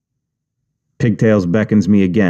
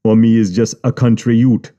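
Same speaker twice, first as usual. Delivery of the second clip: put-on Jamaican accent. Boss voiced.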